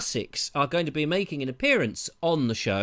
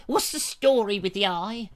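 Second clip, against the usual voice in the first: nosy viewer; 5 semitones higher than usual. Retold or imitated. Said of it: "he said".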